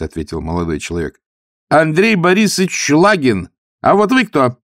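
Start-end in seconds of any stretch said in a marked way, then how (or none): 1.7-4.6 loud, higher cocky voice; young officer. A narrator's reading. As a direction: ask with a smug edge. Officer introduces himself.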